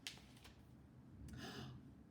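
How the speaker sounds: long whispered gasp of sudden alarm; hushed storyteller's gasp; dramatic gasp. Pretend. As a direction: gasp as if you just remembered. Story reader realizes something forgotten.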